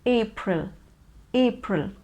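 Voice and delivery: April said as "AY-pril", stressed on AY; correct April. Shown as a demonstration. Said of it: "April is the correct pronunciation".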